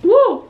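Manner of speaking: high whoop. Real, not acted. Reacting to a dry biscuit.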